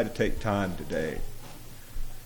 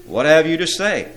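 loud, raised commanding question; thundered demand; 8 semitones higher, 12 dB louder than usual. A narrator's reading. Demon prince presses his silent imps, thundering demand. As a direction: demand an answer with authority. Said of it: "the prince thundered".

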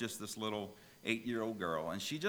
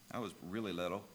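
ordinary delivery

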